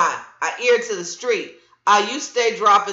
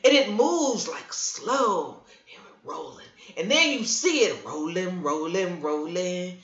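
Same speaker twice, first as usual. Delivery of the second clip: hushed ominous telling; 4 semitones lower, 5 dB quieter than usual. Acted. Describes storm rolling in.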